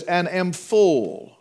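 "full" stretched out slowly; slow quote landing on "full"; stress on "full". Shown as a demonstration. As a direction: hold "full" long for emphasis. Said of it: "I want to emphasize the word full".

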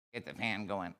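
put-on soft, quiet baby talk. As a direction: say it like a little baby. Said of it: "Say it in a baby voice".

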